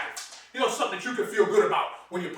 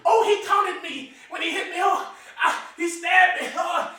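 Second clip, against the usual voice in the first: mock victim; 7 semitones higher, slower than usual. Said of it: "She said".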